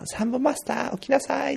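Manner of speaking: かなり高い裏声で母親の真似; 台所から呼ぶ母親を声量抑えめにまねる; 息子を起こす母親. Retold or imitated. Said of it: "大きな声で". (高い声で起こす母親のまね)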